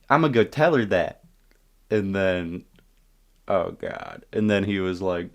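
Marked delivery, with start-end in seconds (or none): 0.1-1.2 put-on higher, louder kid's threat; lightly voiced little tattletale; imitated tattling kid. Little kid threatens to tell his mom, imitated tattling.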